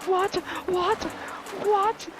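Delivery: high, escalating what; rising startled whats. Real, not acted. Gamer surprised by something on screen, asking what in alarm.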